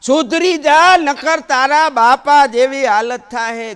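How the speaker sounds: a mother yelling at her runaway son; scolding mother. Acted. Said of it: "છોકરાને ખીજાતા જાતા હતી". (ગુસ્સે થયેલી માની ધીમી, ઊંચી બૂમ)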